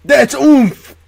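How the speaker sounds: excited squeal. Real, not acted. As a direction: fling it out high and loud. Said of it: "What does that mean".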